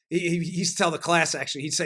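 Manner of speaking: ordinary talk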